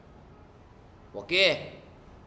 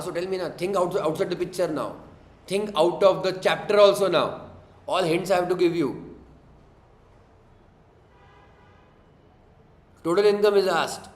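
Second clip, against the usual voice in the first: impatient urging; 5 semitones higher, 3 dB louder than usual. Real, not acted. Teacher pushes students for answer.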